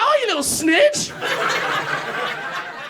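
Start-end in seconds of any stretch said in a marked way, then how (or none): 0.0-1.1 put-on high, loud baby-talk telling off; betrayed by the sniffer dog, in sing-song dog voice; dog voice scolding. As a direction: sweetly scold the dog as a traitor. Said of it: "So I was still doing the voice".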